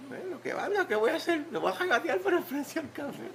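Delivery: very high mock-protesting voice; comic exasperation. Preacher mocking the idea of haggling over coffee, comically exasperated. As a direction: play it high-pitched and put-on, half laughing. Acts out comic reaction to price question.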